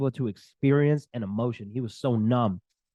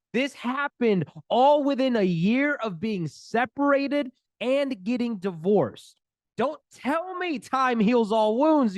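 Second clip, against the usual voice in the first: fervent preaching; 10 semitones higher, slower than usual. Real, not acted. Coach preaching to viewers.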